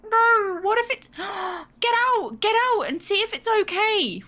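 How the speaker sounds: high, panicked, near tears; mock-frantic pleading to check the badger; panicked distress. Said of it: "I was like".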